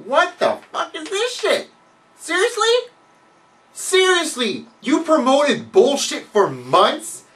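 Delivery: high, loud, disbelieving rant; mock outrage. Ranting at Marvel over the Red Hulk, high outraged disbelief. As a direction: rant in fake shock at the comic's cheat. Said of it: "I'm like".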